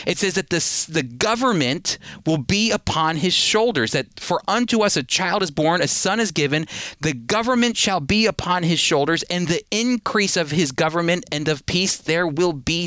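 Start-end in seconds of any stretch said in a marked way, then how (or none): none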